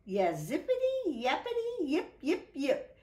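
high excited puppy yapping; yappy dog. Acted. Zippity the stray bounds about, yapping high. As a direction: yip like a bouncy little dog.

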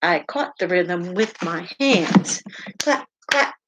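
lively, sing-song picture book read-aloud; animated storytime voice with playful sound words; reading to children. Acted. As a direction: read it out to little listeners with bounce.

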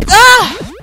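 sudden high, loud cry; startled yell. Real, not acted. Player caught mid-move, startled yell. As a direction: break off into a yelp.

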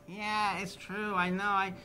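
Jimmy excusing himself to Steinman; imitating Jimmy. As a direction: shrug off the blame in a light impression.

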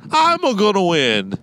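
high hammed-up Italian Mario voice; Mario impression. Mocking the date with a high cartoon Italian Mario voice. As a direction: squeal it like Super Mario in a cartoon Italian accent. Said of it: "I will tell you how Francesca talks".

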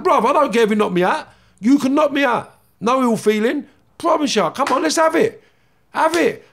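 very high, loud goading; fired-up re-enacted call-out; retold fight challenge. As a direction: dare him to swing, voice pitched right up.

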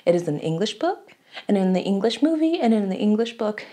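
usual speaking voice

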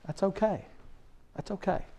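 hushed, kind reassurance; tender reassurance. Real, not acted. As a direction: comfort the weak ones softly. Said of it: "tender reassurance".

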